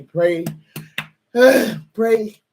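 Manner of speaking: real high, loud sob into one word; crying. Real, not acted. Host begging viewers to pray for her, breaking into tears. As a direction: break down on a plea, voice jumping up. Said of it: "I'm up here crying".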